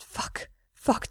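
gasped, almost unvoiced; airy gasp under the breath. A narrator's reading. Mikey gasping at Gerard's touch, airy gasp.

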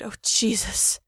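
breathy whispered moan; gasped moan. A narrator's reading. Mikey moaning to Gerard, whispered moan. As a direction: let it out on a breath. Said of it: "gasped and moaned".

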